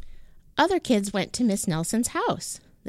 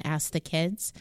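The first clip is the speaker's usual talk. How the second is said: plain reading voice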